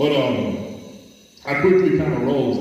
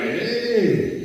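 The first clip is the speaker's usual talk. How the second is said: put-on higher, lightly accented broken English; 8 semitones higher, slower than usual. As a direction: mimic a non-native speaker. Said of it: "you know, broken English".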